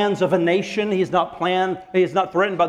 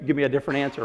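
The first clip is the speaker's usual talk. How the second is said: fast mock complaint; 2 semitones lower, 3 dB quieter, much faster than usual. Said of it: "well, never mind".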